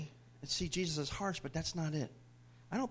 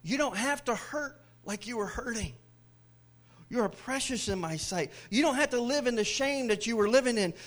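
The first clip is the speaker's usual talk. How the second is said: lightly voiced tender Jesus; 5 semitones higher, 4 dB louder than usual. Jesus comforting the woman, gentle then rising. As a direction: soothe her, then send her off. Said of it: "He was saying".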